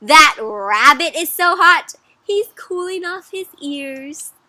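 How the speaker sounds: high, slow, insistent rebuttal; hammed-up storybook disagreement; emphatic contradiction. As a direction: argue back with stretched-out words. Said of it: "And this person says".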